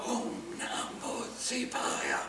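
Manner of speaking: soft slow whisper of Om Namah Shivaya; whispered on the inhale. Shown as a demonstration. Teacher whispers the mantra while inhaling. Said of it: "And we'll inhale".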